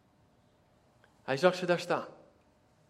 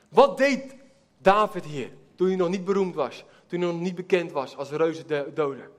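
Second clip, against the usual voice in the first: ordinary talk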